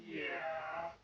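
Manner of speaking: soft, doting pet talk; hushed, affectionate murmuring to a pet; tender cooing. Real, not acted. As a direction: coo quietly over a beloved dog. Cooing over the dog.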